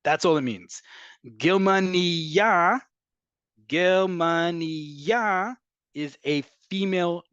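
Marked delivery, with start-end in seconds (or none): 1.4-2.8 slow Germania, higher, landing loudly on a rising final YA; stress on Germania's last syllable. Shown as a demonstration. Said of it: "emphasis on the last syllable". 3.7-5.6 Germania drawn out, rising onto a long final YA; stress on Germania's last syllable. Shown as a demonstration. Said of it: "emphasis on the last syllable".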